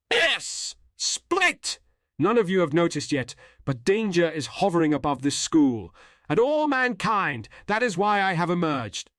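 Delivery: high-pitched, portentous mystery figure; cloaked figure proclaiming. Acted. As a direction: announce looming danger in a high character voice. Voices the cloaked figure Boogie Pop.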